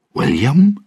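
high, rising surprised question. Acted. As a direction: ask in sudden surprise. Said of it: "Now Bootstrap was surprised".

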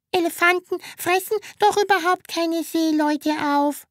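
put-on high girl's voice, pert objection; objecting girl. A narrator's reading. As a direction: object like a clever little girl. (einwendendes Mädchen, hohe Stimme)

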